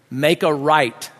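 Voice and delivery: slow, deliberate child's instruction, acted; measured driving command; giving directions. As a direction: draw out each word of the order. Boy gives directions, dad echoes.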